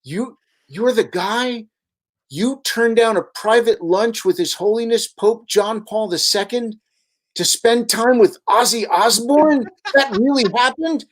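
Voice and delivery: a priest stunned, half laughing; amazed priest. Retold or imitated. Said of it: "the priest looks at me and goes".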